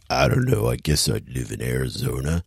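low, mumbled Rambo voice drops to a murmur. Retold or imitated. Retelling Rambo's line to a friend, low mumble. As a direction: sink the words into a low mumble. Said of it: "And he's like".

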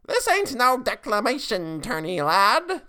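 put-on high, loud old witch voice; high witch scold; crone declaring. A narrator's reading. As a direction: lay it down like a cranky old crone. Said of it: "the crone declared".

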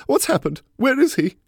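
high, loud, frantic woman; panicked. A narrator's reading. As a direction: cry out in alarm for a hurt pet. Owner panics over hurt dog.

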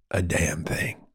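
whispered aside. Real, not acted. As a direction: drop to a whisper. Whispered aside mid-sentence.

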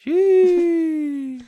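high squeaky drawn-out sheesh; mock squeal. Repeats the mock exclamation.

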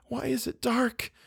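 very high, soft plea; frightened attempt to ask. A narrator's reading. Eleanor trying to ask Theodora, frightened hush. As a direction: ask in a tiny, fearful voice. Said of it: "she tried to ask".

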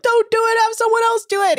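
high, loud panicked plea; shrill worried shout from the crew. Retold or imitated. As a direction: cry out in alarm for him to stop. Crew freaking out at captain.

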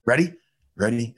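low stern cop voice; Judge Dredd voice. Retold or imitated. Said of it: "You like that?".

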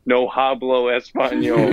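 "hablo" with an English H sounded, HAH-blo; bad learner Spanish, "hablo" with a breathed H; H's pronounced. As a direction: say "hablo" wrongly with an audible H. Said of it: "people who pronounce the H's".

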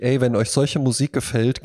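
ordinary delivery

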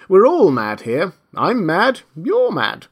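lightly voiced, languid cat; snooty bored cat. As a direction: drawl it like an unbothered cat. The cat's voice.

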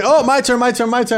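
high-pitched, eager begging for a turn; mock-excited, high and repetitive; eager interjection. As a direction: pipe up like an impatient volunteer. Acting eager to take turn.

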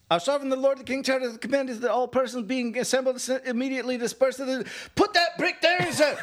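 high-pitched British proclamation reading; hammed-up British town crier; mock British accent. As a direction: shout it out as a British official under attack. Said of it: "you have to read it in British, in a British accent".

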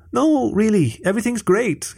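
high, hasty-sounding denial; blurted. A narrator's reading. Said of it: "he blurted quickly".

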